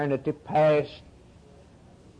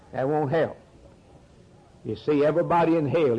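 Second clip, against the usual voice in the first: ordinary talk, nothing marked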